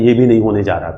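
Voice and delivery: ordinary talk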